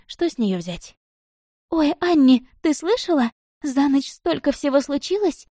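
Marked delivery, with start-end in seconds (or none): 1.7-5.5 put-on high, excited girlish chattering. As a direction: gush the gossip in a rush. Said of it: "затараторила Маруня".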